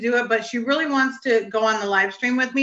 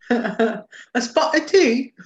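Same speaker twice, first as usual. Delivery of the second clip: slow, laughing put-on British accent; a playful posh British accent through laughter; mock British accent; much slower than usual. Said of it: "[name] does a really good British accent".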